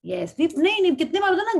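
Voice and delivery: ordinary talk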